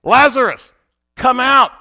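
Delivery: a commanding shout into the tomb; loud call. Retold or imitated. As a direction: call him out of the grave at full volume. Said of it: "he says in a loud voice".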